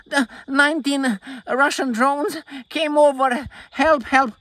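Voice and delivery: high, hammed-up cry for help; high mock panic, help help; mock panicked cry. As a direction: play a fake-frightened victim calling out. Mocking Polish panic.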